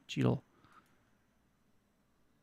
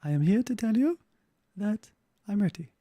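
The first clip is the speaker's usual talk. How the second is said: caller; 4 semitones higher than usual. Pretend. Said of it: "And you're like".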